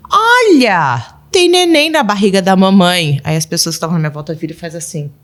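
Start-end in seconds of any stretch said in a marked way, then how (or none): 0.1-3.2 put-on higher and louder, a kid blurting it out in church; slow, proud little-girl announcement; loud child announcement. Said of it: "me vira e fala bem alto". (anúncio alto de criança, mais agudo e lento)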